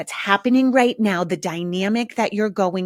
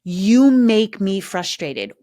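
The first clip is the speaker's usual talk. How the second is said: slow, louder, stress on You; mock accusing a child; blaming line; 5 dB louder, slower than usual.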